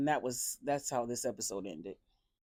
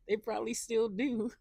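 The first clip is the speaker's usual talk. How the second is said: mock deadpan quip; 9 semitones higher, 4 dB louder than usual. Casey's retorting quote, acted.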